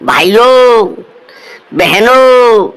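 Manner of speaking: teacher imitates Modi's famous speech opening; Modi impression. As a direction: greet the crowd like Modi at a rally. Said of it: "आपको मोदी नजर आता होगा".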